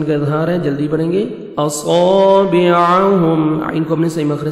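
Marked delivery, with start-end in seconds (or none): 1.6-3.6 slow, drawn-out melodic tarteel; Quran recitation. Shown as a demonstration. Tajweed teacher reciting a verse for students, melodic and slow. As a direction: chant each syllable, stretching the vowels.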